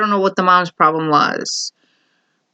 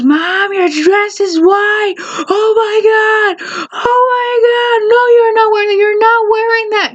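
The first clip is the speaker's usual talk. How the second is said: freaking-out bride; 12 semitones higher than usual. Retold or imitated. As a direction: wail it like a horrified bride.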